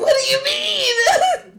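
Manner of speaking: high, slowed, shouted what do you mean; startled shout at a friend; shocked outburst. Real, not acted. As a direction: cry out in disbelief.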